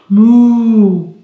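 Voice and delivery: long, loud moo; cow moo. Shown as a demonstration. Teacher answering children about the cow, long mooing. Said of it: "And the cow?".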